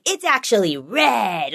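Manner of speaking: slow, drawn-out mimicked contradiction; lightly mocked defiance; defiant child. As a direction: imitate a cranky kid insisting on the opposite. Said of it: "so cranky and so defiant".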